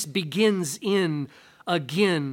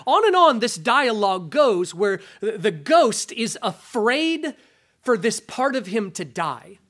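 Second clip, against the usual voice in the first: ordinary talk, nothing marked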